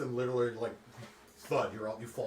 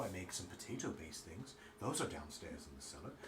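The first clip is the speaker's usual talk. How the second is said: low, soft, matter-of-fact baker; quiet baker voice; 4 semitones lower, 5 dB quieter than usual. Acted. Baker NPC lists goods.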